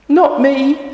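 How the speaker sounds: put-on very high, loud little girl. Girl passing the job to the boy, very high. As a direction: refuse like a small girl. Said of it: "says the girl".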